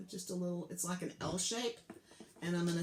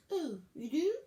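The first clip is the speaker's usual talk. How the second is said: startled frog; 9 semitones higher, 5 dB louder than usual. Acted. Frog reacts to alligator's answer.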